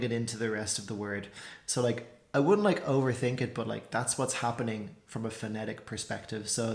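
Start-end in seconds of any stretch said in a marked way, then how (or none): none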